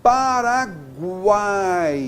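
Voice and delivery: "Paraguai" drawn out slowly, final three vowels unbroken; one-syllable triphthong. Shown as a demonstration. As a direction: stretch "Paraguai", gliding through guai as one syllable. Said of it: "Tudo na mesma sílaba".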